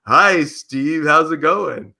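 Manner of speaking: overplayed chummy hello; mock jovial greeting. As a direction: greet a buddy you think is pranking you. Playing along with suspected prank call.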